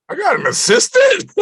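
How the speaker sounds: loud, very high squeal of disbelief, breaking into laughter; retold amazement. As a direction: re-enact being thrilled and floored, laughing. Amazed at getting an assistant.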